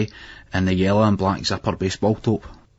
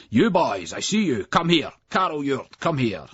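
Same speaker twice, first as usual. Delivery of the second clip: raised, higher stern call; teacher's shout; 6 semitones higher than usual. A narrator's reading. Strict teacher calling out to the late boys, stern. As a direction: order the boys over.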